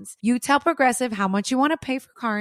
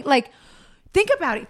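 ordinary talk

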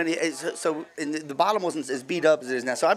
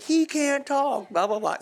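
much slower, high dragged brush-off; slow dismissive; 10 semitones higher, slower than usual. Retold or imitated. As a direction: stretch out a lazy excuse. Friends answering girlfriend's calls.

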